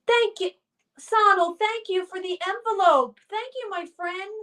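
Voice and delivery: high-pitched, grateful shout-out; delighted thanks. Real, not acted. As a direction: thank a viewer in a high, pleased voice. Thanking viewer for a gift.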